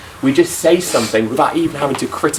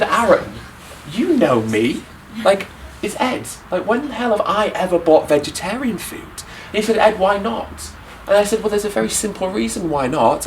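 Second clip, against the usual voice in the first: high, disbelieving retelling of his own reply; incredulous retort; 7 semitones higher than usual. Retold or imitated. Telling friend Aaron a vegetarian pizza is absurd, incredulous. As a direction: replay your younger self's indignant disbelief.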